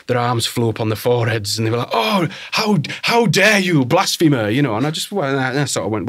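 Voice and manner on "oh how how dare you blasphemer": high, loud mock-scandalised gasp; pearl-clutching mock horror; mock outrage. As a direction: ham up the shocked colleagues. Mock outrage of shocked colleagues.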